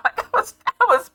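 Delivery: high, giggling through the words; laughing. Real, not acted. Vlogger laughing at her past anger with God. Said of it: "I'm laughing at this now".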